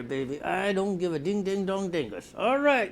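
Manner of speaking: ordinary talk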